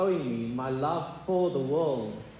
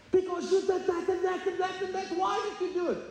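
pleading complaint to God; 13 semitones higher than usual. Acted. Voicing an angry prayer to God.

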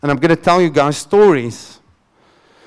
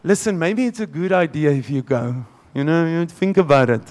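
softer, hesitant, gently suggesting; mild unsure coaxing voice; soft tentative suggestion; 2 semitones lower, 6 dB quieter than usual. Retold or imitated. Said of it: "it's not Jesus going".

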